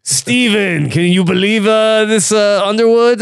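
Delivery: hammy fake Russian accent; a clumsy put-on Russian accent; bad Russian accent. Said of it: "It's a terrible Russian accent".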